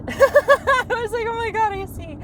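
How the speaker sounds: high, giggly voice through laughter; laughing. Real, not acted. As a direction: laugh your way through the words. Laughing as she retells her reaction.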